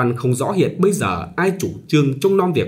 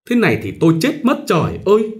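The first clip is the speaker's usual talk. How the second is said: high, fast anguished cry; sobbing lament; 9 semitones higher, 3 dB louder, faster than usual. A narrator's reading. Bride still sobbing in despair, high and hurried. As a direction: voice breaking with despair. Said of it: "vẫn thổn thức".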